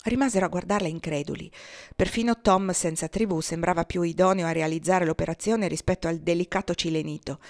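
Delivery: ordinary delivery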